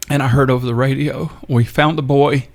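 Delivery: getting emotional. Real, not acted. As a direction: relay the good news through held-back tears. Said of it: "I apologize for getting emotional".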